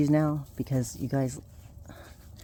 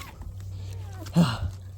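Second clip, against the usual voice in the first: tired sigh; 2 semitones lower, 4 dB quieter than usual. Real, not acted. Exhausted after herding puppies.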